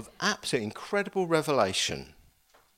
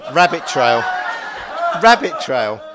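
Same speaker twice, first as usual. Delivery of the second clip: laughing as he catches himself, louder; a loud, laughing self-correction; laughing aside; 12 dB louder than usual. Real, not acted. Preacher to congregation, laughing at his own digression.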